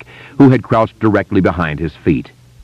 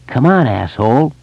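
Henry, taunting bully; 6 semitones higher than usual. A narrator's reading. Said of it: "Henry said".

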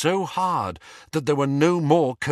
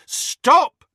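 higher, louder Stop shouted hard; 8 dB louder than usual. Acted. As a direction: bark Stop at full force. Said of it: "shouted Harry".

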